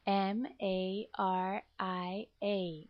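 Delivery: letters spelled slowly one by one; slow spelling. Shown as a demonstration. Teacher spelling a name for the class, slow. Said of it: "The first word is Maria".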